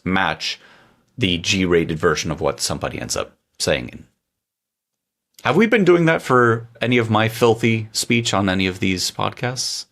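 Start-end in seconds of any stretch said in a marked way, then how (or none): none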